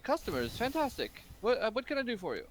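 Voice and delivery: high, fast merchant gushing over customers; eager shopkeeper. Acted. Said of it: "he's like".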